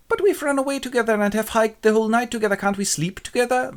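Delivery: high-pitched wheedling protest; pleading. Acted. Lysander asking Hermia to sleep together, pleading.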